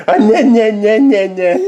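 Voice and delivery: high, loud nasal-syllable chant; mock a fussy baby to get giggles; playful high "nah-nah" imitation; mimicking baby fussing. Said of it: "your brother and the nah, nah, nah".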